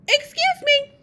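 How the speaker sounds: put-on high, loud kid voice; child's excuse me. A narrator's reading. Reader voices David after his burp, high loud voice. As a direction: pipe it up loudly like a little boy.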